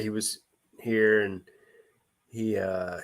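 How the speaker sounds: usual speaking voice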